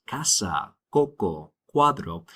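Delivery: casa, coco, cuadro each starting with a K sound; hard K for the C in casa, coco, cuadro. Shown as a demonstration. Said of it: "you have that hard sound".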